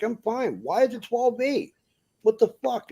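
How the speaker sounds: to a trucker who stopped, retold high exasperation. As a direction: throw it back at him, fed up. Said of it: "I'm like".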